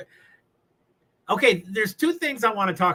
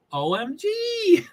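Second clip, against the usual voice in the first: laughing, high; 12 semitones higher, slower than usual. Real, not acted. Laughing while reading.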